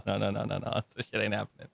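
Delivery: normal talking voice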